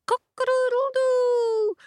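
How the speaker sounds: high loud crowing cock-a-doodle-doo; rooster crow. A narrator's reading. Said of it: "The cock crowed".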